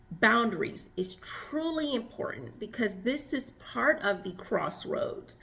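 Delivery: ordinary talk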